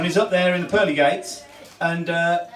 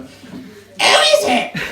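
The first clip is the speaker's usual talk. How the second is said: very high, slow squawking parrot; 5 dB louder, much slower than usual. Acted. Parrot squawking at the knocker. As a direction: screech it out like a parrot.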